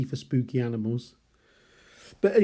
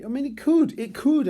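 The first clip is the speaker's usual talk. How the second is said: suddenly squeaky, much higher and louder; squeaky sing-song maybe; high squeak; 13 semitones higher, 4 dB louder than usual. Real, not acted. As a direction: pitch the doubt up high. Unsure musing, sudden high voice.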